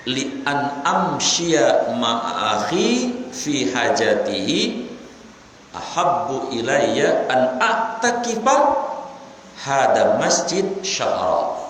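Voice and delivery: drawn-out, chanted hadith reading; slow Arabic recitation. Acted. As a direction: recite each word slowly and reverently.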